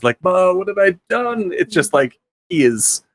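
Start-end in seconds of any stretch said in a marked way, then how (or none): 0.2-1.5 high, slow melodramatic lament; mock romantic weeping. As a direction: ham it up as a weepy romantic hero. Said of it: "some romantic weepy tears".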